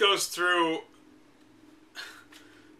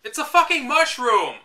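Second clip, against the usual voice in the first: higher and louder, fed up; exasperated outburst; 7 semitones higher, 4 dB louder than usual. Real, not acted. Griping at the strategy guide, exasperated outburst. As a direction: blurt it out in disbelief at the silly name. Mocking the guide's odd term.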